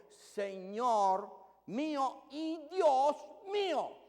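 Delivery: very slow, high and loud, each word drawn out in shaken awe; trembling awe. Acted. Thomas answering the risen Jesus, slow trembling awestruck cry. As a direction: let the words tremble out as he falls before his Lord. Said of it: "me imagino que estaba temblando".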